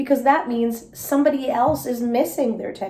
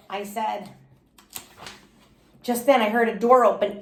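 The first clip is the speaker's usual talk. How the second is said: ordinary delivery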